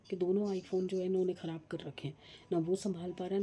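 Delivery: normal talking voice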